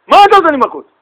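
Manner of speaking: loud, high, irritated challenge; angry retort. Retold or imitated. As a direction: snap back at the one who hit you. Stranger turns angrily.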